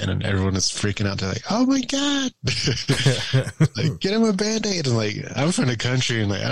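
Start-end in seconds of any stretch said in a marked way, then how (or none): 1.5-2.3 much higher, shrill-free mock alarm; mock panicked relatives. Relatives freaking out over his bloody shoulder, high mock alarm. 4.0-4.8 much higher, shrill-free mock alarm; mock panicked relatives. Relatives freaking out over his bloody shoulder, high mock alarm. Family fussing over him.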